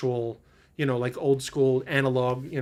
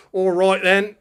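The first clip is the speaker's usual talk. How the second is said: louder, higher mock British accent; generic English accent; 6 semitones higher, 9 dB louder, slower than usual. Shown as a demonstration. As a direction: put on a stock British accent, loudly. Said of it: "I have, like, a very generic".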